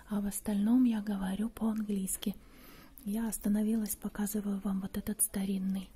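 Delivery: whispering softly so as not to disturb the shop; hushed whisper. Real, not acted. Host guides viewers through an antique shop, whispering. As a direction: keep it a quiet, breathy whisper among the antiques.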